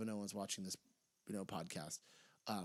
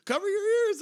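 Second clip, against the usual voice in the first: much higher, much louder Lawler jab; mock roast; 12 dB louder than usual. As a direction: yell it as a roasting commentator. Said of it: "Jerry the King Lawler just roasted him. He goes".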